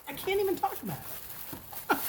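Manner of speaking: high, excited repeat; gushing. Real, not acted. Reviewer gushing over pink sugar wax, giddy.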